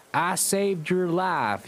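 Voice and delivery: hammed-up angry shout, slow; mock yell in a Southern accent; yelled angrily. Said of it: "he yelled angrily".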